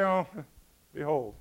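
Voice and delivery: low, offhand throwaway with a chuckle; mock indifference. How John didn't announce Jesus, mock casual mumble. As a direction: say it limply, as if it hardly matters. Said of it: "John didn't say".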